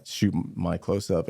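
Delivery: usual speaking voice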